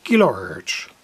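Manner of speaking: usual speaking voice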